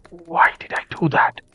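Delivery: a hushed self-reproach; whispered thought. A narrator's reading. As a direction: breathe it as a private thought. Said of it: "I thought to myself".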